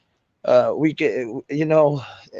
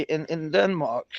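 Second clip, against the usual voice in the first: ordinary talk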